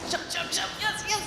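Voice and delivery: put-on high, bright calls to the kids; cheering each jump; excited cheering. Shown as a demonstration. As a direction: cheer them on as they jump. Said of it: "can you guys do some jumpies for me?".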